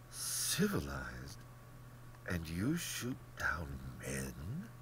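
slow, half-whispered incredulity; appalled, drawn-out near-whisper; hushed disbelief. Acted. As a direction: question him under your breath, drawing it out. Rainsford's appalled challenge to general.

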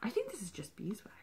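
soft whisper; hushed realization. Real, not acted. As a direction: mutter the discovery under your breath. Figuring out item.

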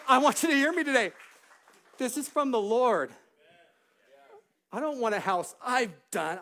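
loud, high, fervent proclamation; impassioned preacher at the climax; full-force preaching. Real, not acted. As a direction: cry it out to rouse the congregation. Preaches the miracle, urging praise.

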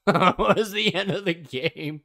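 slow words through laughter; laughing. Real, not acted. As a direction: laugh while asking. Laughing after jump scare.

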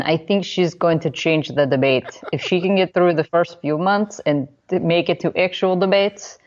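hammed-up Russian accent; made-up Russian accent. Host reads a commentator's quote in mock Russian accent. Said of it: "work on my Russian accent".

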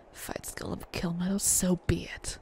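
slow, whispered vow; hushed, drawn-out acceptance of fate; grim whisper. Pretend. Solemn vow in game dialogue.

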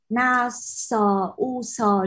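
"na sờ u sờ" slowly, each letter its own syllable; letter-by-letter reading. Shown as a demonstration. Teacher shows students the wrong reading, "na sờ u sờ" letter by letter. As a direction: spell out "na sờ u sờ" very slowly. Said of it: "nó không phải là".

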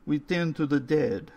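put-on calm the boy as the old caretaker; old man, reassuring.

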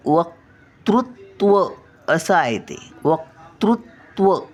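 teacher stretches वक्तृत्व clearly; careful model word. Shown as a demonstration. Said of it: "जरा उच्चार लक्षात ठेवायचे". (वक्तृत्व स्पष्ट, सावकाश उच्चार)

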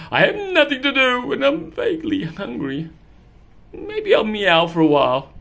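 a hint of a bored hungry cat.

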